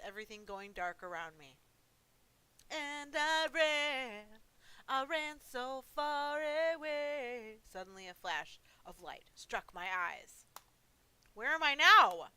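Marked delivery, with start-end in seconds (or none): 2.7-7.6 drawn-out, louder and higher; slow, lifted, dreamlike narration; slow dramatic narration. Acted. 11.3-12.3 loud, higher, startled question; louder, alarmed question. Acted. Protagonist startled after flash.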